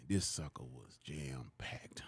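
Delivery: hushed, slow, unvoiced; awed whisper. Real, not acted. Seeing the crowd of thousands, hushed and awed. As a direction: whisper it in awe.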